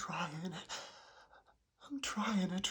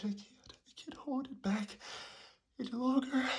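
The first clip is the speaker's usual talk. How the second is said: about to sneeze; 4 semitones higher, faster than usual. Acted.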